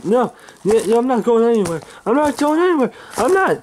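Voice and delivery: high-pitched, stubborn protest; defiant refusal. Acted. Man being thrown out, refusing to go, defiant. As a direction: dig in and refuse, voice climbing.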